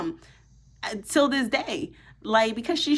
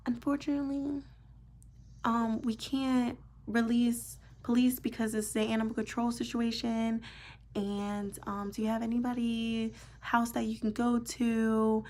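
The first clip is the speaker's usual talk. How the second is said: mock dispatcher voice; 2 semitones higher, 5 dB quieter than usual. Mocking the dispatcher's reply.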